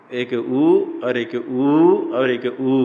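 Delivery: each उ drawn out slowly; three vowel lengths. Shown as a demonstration. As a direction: demonstrate उ short, long, then extra-long. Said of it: "सूत्र में जो उकार कहा गया". (उ को धीरे-धीरे बढ़ती मात्रा में)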